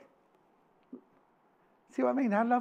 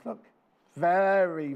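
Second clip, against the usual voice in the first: usual speaking voice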